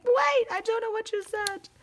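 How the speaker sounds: squeaky high panicked voice; high mock panic. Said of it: "and I'm like".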